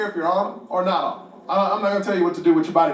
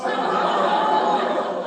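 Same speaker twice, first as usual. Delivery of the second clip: laughing. Real, not acted. Laughing at the punchline.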